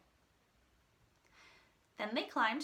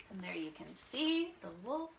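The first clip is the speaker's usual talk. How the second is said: plain reading voice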